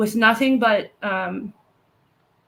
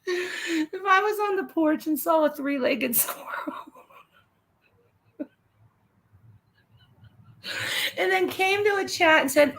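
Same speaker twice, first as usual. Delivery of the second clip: higher, half-laughing telling; giggly higher storytelling; laughing through it; 6 semitones higher than usual. Real, not acted. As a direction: talk while cracking up. Laughing while retelling.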